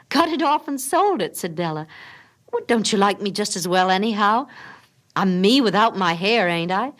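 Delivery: insist, half pleading; Della's voice. Acted.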